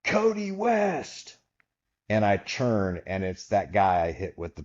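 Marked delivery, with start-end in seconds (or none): 0.0-1.4 long, high, drawn-out call across an arena; crowd yell. Retold or imitated. Fan in the crowd shouting the wrestler's name, drawn out and high. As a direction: imitate a fan hollering a name from the stands. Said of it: "I hear somebody in the crowd yell".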